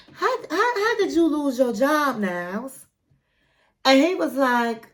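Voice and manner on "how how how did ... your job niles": high, put-on questioning voice as Matilda; imitating Matilda. Matilda pressing Niles about his lost job, in a high imitated voice. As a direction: play the girlfriend pressing him about the job.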